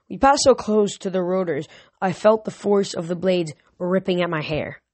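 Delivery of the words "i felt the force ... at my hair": ordinary delivery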